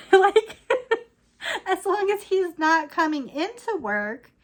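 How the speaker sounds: bursting into laughter mid-sentence; laughing. Real, not acted. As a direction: laugh it out, amused at yourself. Laughs at her own answer.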